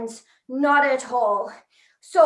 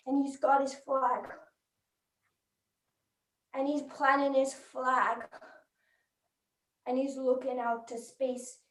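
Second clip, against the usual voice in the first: hushed storytelling; 2 semitones lower, 10 dB quieter than usual. Acted. Reporter describing Neil planting his flag, hushed. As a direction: set a still, solemn scene quietly. Solemn scene of Neil on moon.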